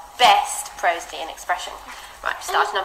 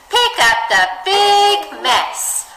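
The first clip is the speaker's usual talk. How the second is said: "big" stretched high and loud; big stressed; 10 semitones higher, 8 dB louder, much slower than usual. Shown as a demonstration. Teacher reading, "big" stretched high and loud. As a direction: make "big" sound enormous, high and slow. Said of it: "So it'll be".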